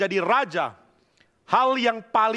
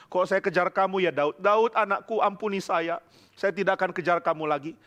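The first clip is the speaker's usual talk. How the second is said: normal talking voice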